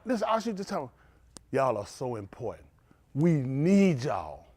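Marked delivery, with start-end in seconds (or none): 1.6-4.5 tender praise. Retold or imitated. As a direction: say it gently, very slow. Lady's tender praise retold.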